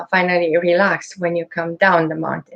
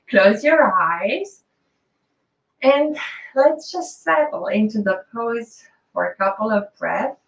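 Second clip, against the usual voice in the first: guided meditation; 4 semitones higher than usual. Acted. Leads a relaxation practice.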